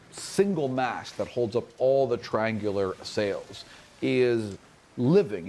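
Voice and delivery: usual speaking voice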